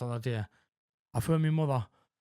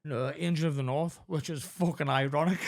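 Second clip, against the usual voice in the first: ordinary talk, nothing marked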